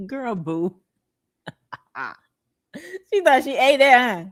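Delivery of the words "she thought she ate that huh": laughing, louder and higher, mocking her. Real, not acted. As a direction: laugh through the line at her. Laughing at girl on screen.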